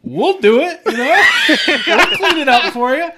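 high, loud Canadian yarn-spinner; eager Canadian workman voice; Canadian mechanic. Acted. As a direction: chuckle through a hammed-up Canadian tale. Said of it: "Yeah, they're Canadian".

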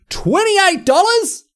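high, slow, stunned question; can't believe what it costs now; shocked disbelief. Real, not acted. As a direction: gasp out the price in disbelief. Shocked at the burger price.

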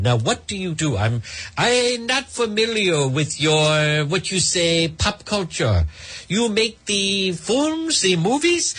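slow, high put-on Spanish accent, rising on movies; mock Spanish accent. Host mocking Hilaria meeting Alec Baldwin, in a fake Spanish accent. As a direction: play the clueless foreigner, drawling each word. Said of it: "her accent and just the way she talks".

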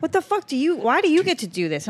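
high, whining indignation; mock jealous protest. Bartender envying all-day drinkers, mock indignant protest.

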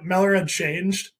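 ordinary talk

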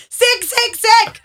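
high, loud squeal of sick; mock-disgusted shout. Co-host teasing a caller about his dad, mock-disgusted shout.

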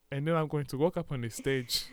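mock Ghanaian accent; put-on Ghanaian accent. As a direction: speak with a fake Ghanaian accent. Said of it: "And I can do a dialect".

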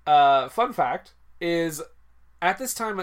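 ordinary delivery

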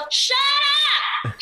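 drawn-out imitated yell; yelled shut up. Boyfriend finally acting his one line, yelling. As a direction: let it rip, as if across the street. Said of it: "And he yells".

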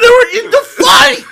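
high cracking shout of disbelief; incredulous shout. Real, not acted. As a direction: yell it in disbelief. Heated argument, incredulous outburst.

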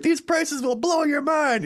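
high, slow cheesy ad read; ad announcer voice. Retold or imitated. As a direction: sell it like a cheesy commercial.

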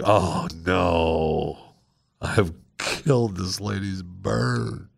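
soft, slow dread. Retold or imitated. Remembering the dead bird, soft slow dread. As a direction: let the horror sink in quietly. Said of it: "That feeling like".

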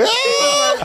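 high-pitched mock scream. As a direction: shriek in alarm. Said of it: "landed in Memphis like".

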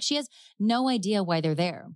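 ordinary talk

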